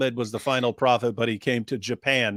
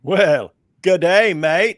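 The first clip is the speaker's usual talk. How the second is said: put-on Australian accent; 5 semitones higher, 7 dB louder, much slower than usual. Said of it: "beautiful accent".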